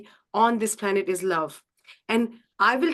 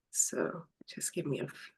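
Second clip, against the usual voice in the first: under her breath while setting up; quick whisper to herself; whispered aside; 7 semitones lower, 11 dB quieter than usual. Real, not acted. Muttering to herself while fixing the stream, whispered. Muttering during tech setup.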